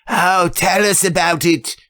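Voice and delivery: high, eager cry of curious ladies; eager exclamation. Acted. Women begging the judge for his story, high and eager. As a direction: beg for the story, bright and high. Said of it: "Several women exclaimed at once".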